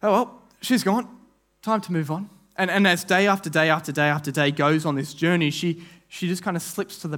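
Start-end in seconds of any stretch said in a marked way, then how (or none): 0.0-2.3 dismissive shrug. Retold or imitated. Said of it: "Or maybe they were sitting there going".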